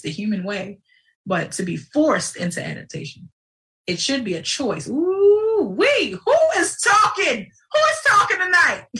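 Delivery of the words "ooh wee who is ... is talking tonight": high, loud whoop with a cry in it; yelling. Real, not acted. Host hyping herself to listeners, whooping shout. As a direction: shout it out, carried away. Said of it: "Sorry, y'all, I yell".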